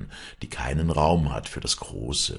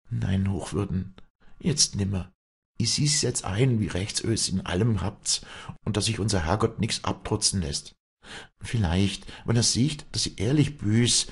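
high, earnest dialect voice; heartfelt peasant woman hoping for mercy; Walli, penitent; 7 semitones higher than usual. Acted. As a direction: confess humbly to the priest. Walli penitent before priest.

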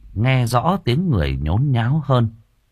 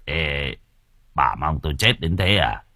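low, soft, slurring hungover man; slurred drunk drawl; 7 semitones lower, 5 dB quieter than usual. Acted. Hungover husband mumbles to his wife, slurring. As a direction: drawl it like he just sobered up. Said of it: "cất giọng lè nhè".

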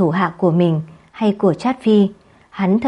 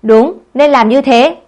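higher, louder, slow firm assent; loud slow agreement; 4 semitones higher, 7 dB louder, slower than usual. A narrator's reading. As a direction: affirm the sentence together. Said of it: "đồng thanh".

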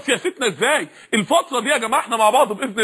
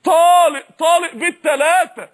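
emphatic repeated divorce; 4 semitones higher, 5 dB louder, slower than usual. Retold or imitated. Divorcing the world, rising.

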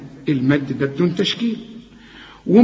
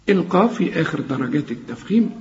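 ordinary talk

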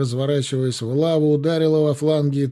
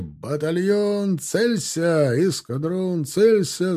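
shouted commands; 6 semitones higher, much slower than usual. A narrator's reading. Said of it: "закричали".

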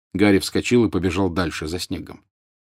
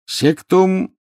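high, loud incantation; shouted spell; 12 semitones higher, 5 dB louder than usual. A narrator's reading. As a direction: hurl the curse in a high yell. Harry casts a curse at Snape. (высокий громкий выкрик заклятия)

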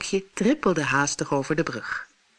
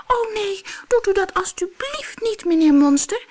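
much higher, frightened whimpering squeak; squeaking goat; 3 dB louder than usual. Acted. Little goat begs the monster, squeaking in fear. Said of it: "piepte het bokje".

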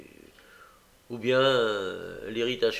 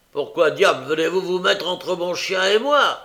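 loud, high angry challenge; furious loud question; menacing demand; 7 semitones higher, 9 dB louder than usual. A narrator's reading. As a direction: threaten the intruder. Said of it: "demanda-t-il avec un geste menaçant".

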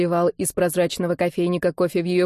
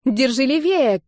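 shouting; 5 semitones higher than usual. Acted. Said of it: "крикнула я".

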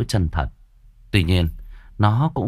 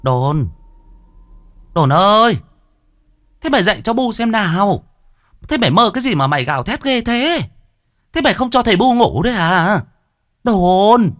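high, loud nagging mother voice; scolding mother; 13 semitones higher, 5 dB louder than usual. A narrator's reading. Mother wakes Đồn from his nightmare, scolding loudly. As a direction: call him sharply awake, pitched way up.

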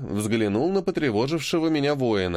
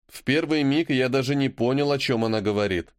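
ordinary delivery

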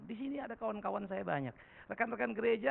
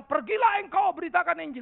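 God's command; 12 semitones higher, 10 dB louder than usual. Retold or imitated.

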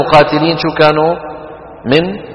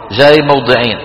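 ordinary talk, nothing marked

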